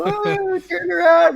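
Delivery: put-on high, loud girlish catcall; shrill hooting fans teasing the peeing guy; catcalling girls. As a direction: mimic girls whistling from the cars. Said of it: "girls and shit start whistling".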